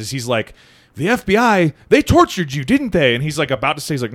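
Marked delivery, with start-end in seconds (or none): 1.0-3.1 high, insistent coaxing question; leading interrogation. Retold or imitated. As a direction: prompt the witness with a pointed, high-pitched question. Acted leading question, manipulators pressing witness.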